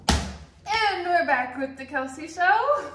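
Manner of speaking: loud, high, drawn-out show intro; show host announcer. Pretend. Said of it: "I just love saying that".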